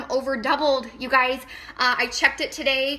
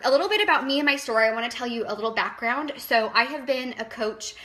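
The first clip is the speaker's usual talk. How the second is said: ordinary delivery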